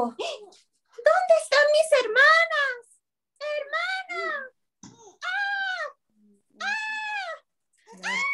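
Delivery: very high, drawn-out calling; distressed little sister. A narrator's reading. Littlest A calling for her missing sisters, high-pitched wail. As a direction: wail for them in a tiny high voice.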